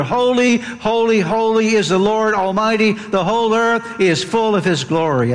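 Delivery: rising, awed call of the angels; exalted proclamation. Acted. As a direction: lift the holy, holy, holy up high. Angels' cry within the reading.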